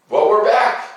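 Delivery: ordinary delivery, nothing marked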